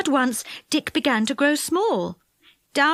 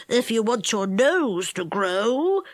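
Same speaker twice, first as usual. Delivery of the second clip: goblin voice; 2 semitones lower, slower than usual. A narrator's reading. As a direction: speak slowly like a wise goblin. Goblin answers.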